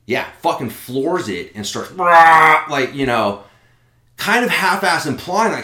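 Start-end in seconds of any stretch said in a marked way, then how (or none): 1.9-2.9 loud, high revving noise; imitated engine roar. Woman driving at him, imitated engine roar. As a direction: make the noise of a car being floored.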